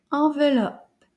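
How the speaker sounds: "Enveloppe" joined up, at a brisker teaching pace. Shown as a demonstration. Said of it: "Now a little bit faster".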